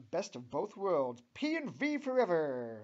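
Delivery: loud, high, gleeful cheer; happy shout. A narrator's reading. Jean cheers on the best of both worlds, shouting happily. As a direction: belt it out like a joyful slogan. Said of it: "shouts out Jean happily".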